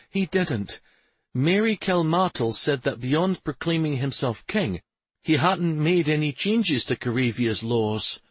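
a hint of a wife explaining; Shari's voice. A narrator's reading.

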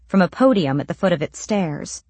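usual speaking voice

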